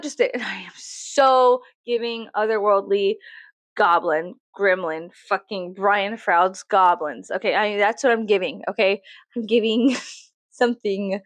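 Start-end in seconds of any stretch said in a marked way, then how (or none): none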